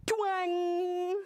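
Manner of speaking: high, ringing twang; drawn-out storybook twang; twang sound effect. A narrator's reading. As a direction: imitate a plucked web string. Sound word in picture book.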